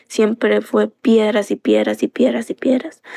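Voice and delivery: ordinary talk, nothing marked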